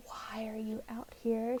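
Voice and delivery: whispered question. Retold or imitated. Retelling Xaden asking Violet on the parapet, whispered. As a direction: breathe the line out, no voice.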